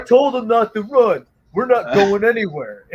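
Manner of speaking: high, stern retold dad; stern dad imitation. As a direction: refuse to leave. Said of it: "my dad's like".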